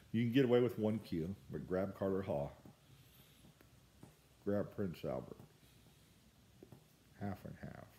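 talking around pipe stem; pipe in mouth. Real, not acted. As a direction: lips gripping pipe. Seen 2: Pipe stem clenched in mouth throughout; smoke visible; out in references.

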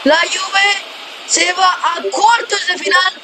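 high shouted victory call; excited goal call. Acted. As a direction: roar the winning goal and the final whistle. Winning hat-trick goal, final whistle.

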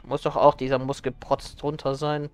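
normal talking voice

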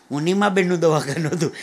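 ordinary talk, nothing marked

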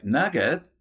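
put-on a child's excited shout of Nugget; excited cry. A narrator's reading. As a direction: call out the find with delight. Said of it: "Joy was the first to cry".

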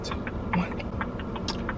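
breathy whispered countdown to cool off; soft, slow self-soothing whisper after getting mad; calming whisper. Real, not acted. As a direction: whisper slowly to calm yourself down. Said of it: "let me calm down".

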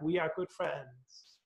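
put-on slow, soft echo of the boy's words; faint echo from far off. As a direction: let it drift back from the valley. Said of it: "the voice came back".

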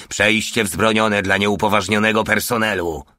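ordinary delivery

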